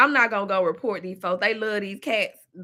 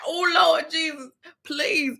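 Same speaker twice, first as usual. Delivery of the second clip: a touch of panic, slow and high; mock panicked prayer; 6 semitones higher, slower than usual. Retelling her worry for her auntie, mild panicked plea. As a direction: plead to the Lord, lightly panicked. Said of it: "But then I started panicking".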